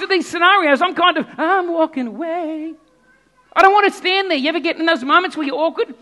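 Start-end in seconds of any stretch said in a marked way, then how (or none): none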